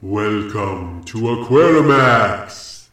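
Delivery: very slow, loud spooky drawl; creepy voice. Shown as a demonstration. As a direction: stretch it out like a horror host. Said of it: "But I could make my voice creepy".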